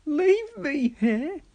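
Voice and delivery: high, slow dismayed wail. Acted. Thomas told he stays in the pit, slow high wail. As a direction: wail in disbelief. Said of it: "wailed Thomas".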